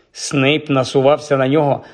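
usual speaking voice

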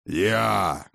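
forceful, drawn-out exclamation; roared. A narrator's reading. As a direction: growl it out as a god's claim. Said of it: "рыкнул Один".

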